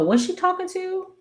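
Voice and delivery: high-pitched wondering aloud; puzzled self-questioning. Real, not acted. Recapper doubting her own memory of the show, puzzled. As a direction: ask yourself in a high, unsure voice.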